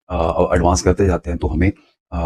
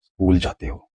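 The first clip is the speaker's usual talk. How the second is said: School said softer but lightly stressed; understated stress demo; 3 dB quieter than usual. Shown as a demonstration. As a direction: pick out school. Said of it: "मैंने stress के साथ".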